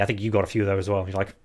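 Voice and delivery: usual speaking voice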